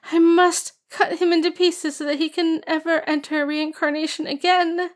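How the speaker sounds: put-on high girlish voice, tearful resolve; young girl swearing revenge through tears; determined young girl. A narrator's reading.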